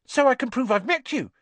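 very high, eager schoolboy; Colin Creevey. A narrator's reading. Colin explaining to Harry why he wants a picture, eagerly. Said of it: "said Colin Creevey eagerly".

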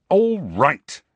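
loud, sharp concession; angry. A narrator's reading. Harry to his friends, angrily giving in. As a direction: give in angrily.

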